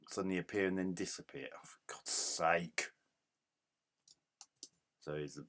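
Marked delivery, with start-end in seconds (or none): 1.5-2.9 exasperated whisper under breath; whispered exasperation. Real, not acted. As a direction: hiss it out in frustration. Exasperated muttering at game.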